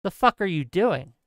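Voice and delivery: normal talking voice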